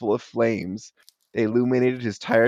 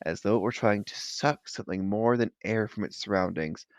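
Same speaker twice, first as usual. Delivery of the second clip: plain reading voice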